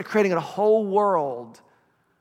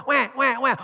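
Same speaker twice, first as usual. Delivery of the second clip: high playful wah wah wah; hungry baby's mock wail; mock baby crying; 6 semitones higher than usual. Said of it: "We cry".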